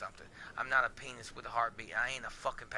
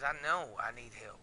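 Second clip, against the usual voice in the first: normal talking voice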